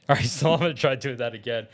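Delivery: normal talking voice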